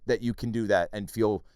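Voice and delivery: ordinary delivery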